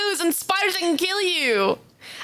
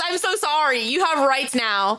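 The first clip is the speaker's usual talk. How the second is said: ordinary talk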